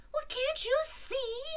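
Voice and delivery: slow, very high loud squeal; shout. Acted. Piglet to unseeing Kanga, squeaky shout. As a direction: shout it in exasperation, tiny and high. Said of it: "shouted Piglet".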